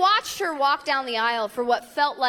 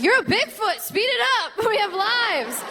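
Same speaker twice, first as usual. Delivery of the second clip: higher, pushy mock heckle at the bride; impatient heckle; 7 semitones higher than usual. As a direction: hurry her up, exasperated. Guest yelling at slow bride.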